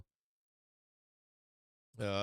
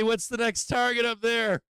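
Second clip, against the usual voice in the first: excited cheering; 8 semitones higher than usual. Real, not acted. Trader still hyped at chart, high excited question. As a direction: push the question out with glee.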